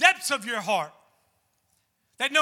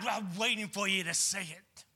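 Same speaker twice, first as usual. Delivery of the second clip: stern waiting God; 8 semitones lower, 11 dB quieter than usual. Retold or imitated. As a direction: lower and softer, a menacing judge. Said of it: "somebody that's waiting for us".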